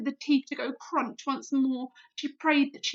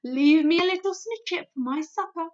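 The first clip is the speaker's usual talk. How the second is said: louder, higher, pleading playfully; BFG, sly; 5 semitones higher, 8 dB louder than usual. A narrator's reading. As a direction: beg for a bit left over. BFG voice, tempting slyly.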